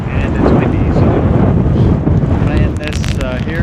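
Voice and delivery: real outdoor near-shout. Real, not acted. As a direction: call it out over the wind. Talking over wind on camera.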